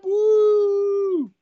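high, loud Ric Flair woo; mock celebratory woo. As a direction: shout it like a cheering fan.

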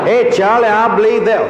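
high, loud shout to a comrade; calling out. Acted. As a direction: yell it across a battlefield, acted. Acts out soldier calling to comrade.

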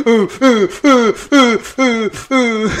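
high, loud fake sobbing ooh; mock blubbering wails, laughing through; mocking boo hoo. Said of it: "Boo-hoo crying".

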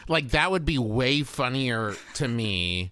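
usual speaking voice

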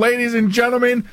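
playful triumphant call to the listeners; mock announcer proclamation. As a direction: trumpet it like a game-show host. Host gloats after stumping guest.

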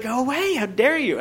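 high, prissy mock outrage. Scolding the handsy bartender, mock indignant. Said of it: "and I was like".

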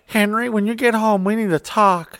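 lightly voiced high mom; a hint of a stern soccer mom. Mom telling husband they must talk, lightly voiced mom. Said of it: "soccer mom lady. She's like".

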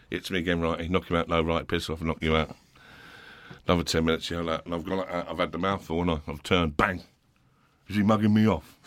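usual speaking voice

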